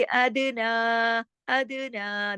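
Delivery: अदना with a bouncing d, long naa; qalqala on daal. Shown as a demonstration. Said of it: "दाल पे कलकला". (अदना में दाल पर कलकला)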